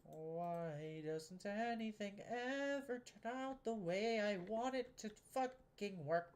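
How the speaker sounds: long drawn-out, high exasperated wail; frustrated wail. Real, not acted. Builder laments the build never working, drawn-out frustrated wail.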